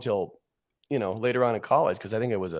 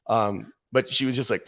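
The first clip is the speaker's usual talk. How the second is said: normal talking voice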